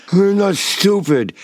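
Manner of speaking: high, slurred stroke speech. Retold or imitated. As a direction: slur it like a stroke patient. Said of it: "I slur at Doctor Kumar".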